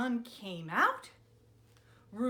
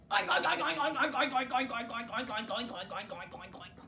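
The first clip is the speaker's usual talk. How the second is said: rooster oinking; faster than usual. Acted. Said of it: "and said".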